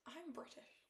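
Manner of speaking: soft whispered quote; quiet whispered reply; whispered retort. Retold or imitated. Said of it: "I said".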